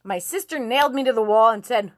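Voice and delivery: ordinary talk, nothing marked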